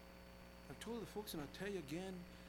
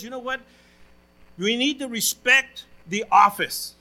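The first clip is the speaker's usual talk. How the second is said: quoting a pastor; 18 dB louder than usual. Retold or imitated. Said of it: "This pastor stood up and he says".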